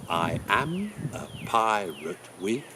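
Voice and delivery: slow, measured syllables on I AM A PIRATE; iambic demonstration. Shown as a demonstration. Teacher shows the class iambic pentameter, slow and measured. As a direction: stretch out each stressed beat in "I am a pirate with a wooden leg". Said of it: "as an example of iambic pentameter".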